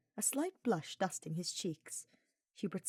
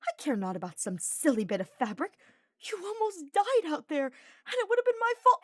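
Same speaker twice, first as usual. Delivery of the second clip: rising high and loud in distress; indignant outburst; 11 semitones higher, 8 dB louder than usual. A narrator's reading. Ferdinand scolding Hubert for apologizing, rising indignant outburst. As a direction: build from scolding to anguished cry. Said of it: "face turned indignant".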